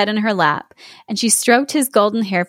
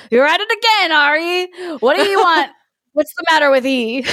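angry bellow; 7 semitones higher than usual. Acted. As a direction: bellow at her, woken up. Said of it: "woke with a bellow".

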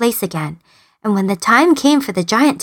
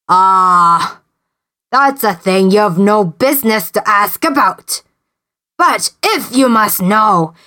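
giant voice. A narrator's reading. Giant answers princess.

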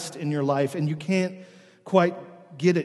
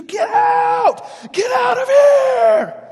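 imitated full-volume night-terror scream; acted shriek at the top of the lungs; terrified scream; 11 dB louder, much slower than usual. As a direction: scream it very high, dragging out the last word. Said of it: "a terrified voice screaming at the top of the lungs".

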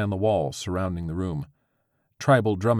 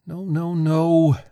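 high, slow panicked squeak; 10 semitones higher, 7 dB louder, much slower than usual. Acted. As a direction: squeak it out in dismay. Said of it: "she squeaked".

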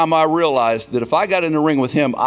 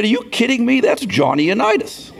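starstruck disbelief; 11 semitones higher than usual. Retold or imitated. A young player stunned to meet his football hero, amazed disbelief. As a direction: pitch jumps high in awed disbelief.

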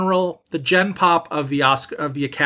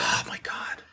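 exasperated whisper; 11 dB quieter than usual. Real, not acted. Shocked at the disagreement.

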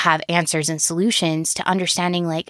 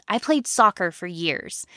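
ordinary talk

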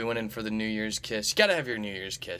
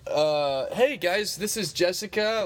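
high, louder showman intro; announcer hype; 8 semitones higher, 5 dB louder than usual. Real, not acted. Host presents roommate to his listeners, hyped announcer. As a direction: present her to the audience with flair.

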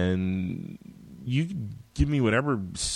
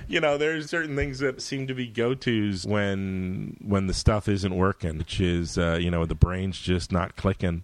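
usual speaking voice

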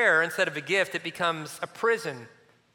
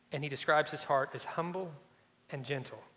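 much softer, tender preacher hush; 4 semitones lower, 8 dB quieter than usual. Real, not acted. Preacher closing on Jesus' invitation, hushed. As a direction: let the words fall to almost nothing.

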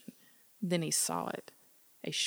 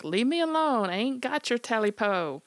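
loud high frightened yell; yelled back; 6 semitones higher, 5 dB louder than usual. Acted. Smitty yells back at the creature, loud scared yell. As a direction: shout it back at the thing. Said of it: "Smitty yelled back".

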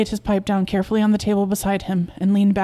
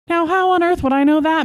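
high, loud exasperated retort; 9 semitones higher, 5 dB louder than usual. Acted. Husband brushes off wife's question about wrinkles, exasperated. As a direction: throw the question back, exasperated.

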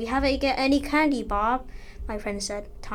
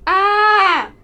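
high scream; 9 semitones higher, 14 dB louder than usual. Acted. Robots approach the boys.

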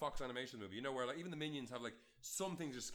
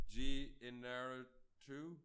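unsure of the name; much slower than usual. Real, not acted. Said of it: "What's his name?".

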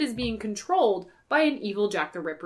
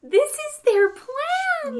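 very high, very slow sing-song; higher mock voice; 4 dB louder, much slower than usual. Mocking the plan.

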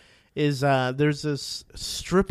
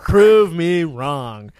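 slow drawn challenge; 2 semitones higher, 7 dB louder, much slower than usual. Real, not acted. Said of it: "Tune in".

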